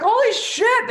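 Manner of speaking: high, loud alarmed yelp; freaked out. Retold or imitated. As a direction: yell it in mock panic. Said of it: "They're like".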